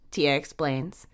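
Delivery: usual speaking voice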